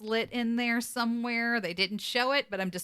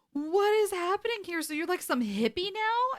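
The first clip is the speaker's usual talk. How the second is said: incredulous; 11 semitones higher than usual. Retold or imitated. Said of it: "Dean says".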